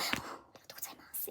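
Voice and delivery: whispered, slow Japanese thank-you; feeble thanks. Retold or imitated. Pitied and handed a plastic bag, whispered feeble thanks. Acts out pitiful, overwhelmed thanks.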